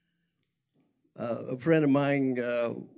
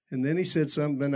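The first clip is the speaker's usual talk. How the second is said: ordinary delivery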